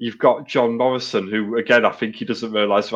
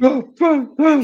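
much higher, slow, loud ring announcer call; 6 dB louder, much slower than usual. Retold or imitated. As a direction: belt the name out slowly like a ring announcer. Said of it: "I was doing the Rob Van Dam".

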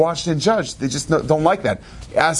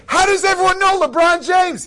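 high-pitched, astonished mock shout; incredulous yelp, pitched way up; bewildered outcry. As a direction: exclaim it in total bafflement. Retold bewildered reaction to being mobbed.

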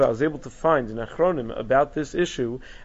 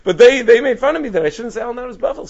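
higher and louder complaint; animated quote; 9 semitones higher, 8 dB louder than usual. Retold or imitated. Teacher voicing the rabbi on the teasing, loud. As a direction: tell of the mockery with heat. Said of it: "And he says".

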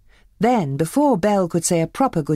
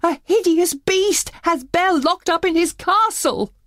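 high, louder, alarmed; frantic cry; 13 semitones higher, 4 dB louder than usual. Acted. Maurice begs the tavern crowd for help, frantic and high. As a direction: raise the alarm in a panic. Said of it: "Maurice cried".

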